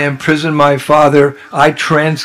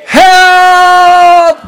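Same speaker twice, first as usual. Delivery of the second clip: very high, loud cry for help; desperate cry; 5 dB louder, much slower than usual. Shown as a demonstration. Soul calling to the Lord, desperate shout. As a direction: scream out as if drowning. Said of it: "That's what it means when you're chanting".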